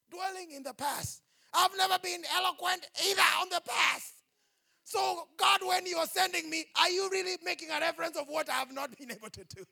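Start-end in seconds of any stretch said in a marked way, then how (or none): 1.5-9.6 Moses crying out loud and high; anguished Moses. Acted. Preacher voicing Moses protesting to God, loud anguished cry. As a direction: wail it high like a desperate Moses.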